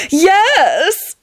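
high, gushing yes; enthused. A narrator's reading. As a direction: burst out with theatrical enthusiasm. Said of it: "Tony enthused".

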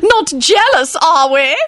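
put-on very high, gloating old lady; delighted crowing. A narrator's reading. Lady Fanshawe teases about jealousy, crowing. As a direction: crow it in a high falsetto, delighted. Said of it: "she crowed delightedly".

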